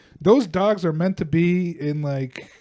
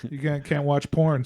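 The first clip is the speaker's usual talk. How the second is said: ordinary talk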